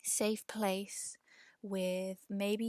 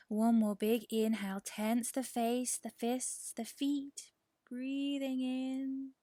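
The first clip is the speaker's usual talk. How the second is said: breath coaching; 3 semitones higher than usual. Shown as a demonstration. Teacher leading one more big inhale, lifted and building. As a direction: raise the voice as the body tenses, then stretch the last words.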